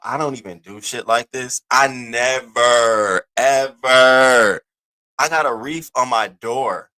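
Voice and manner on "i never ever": "never" and "ever" drawn out, much louder; stress on never ever. Real, not acted. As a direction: lean hard on "never, ever". Emphatic insistence on never.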